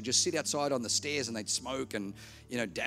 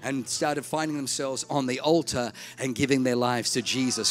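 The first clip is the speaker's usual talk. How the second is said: ordinary talk, nothing marked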